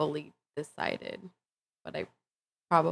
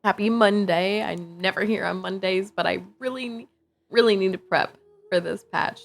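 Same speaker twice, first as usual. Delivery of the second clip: usual speaking voice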